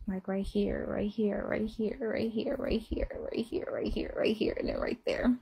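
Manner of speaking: very soft, near-whispered list of spots; hushed intimate pointing. Retold or imitated. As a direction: murmur it softly, spot by spot. Showing where he kissed her.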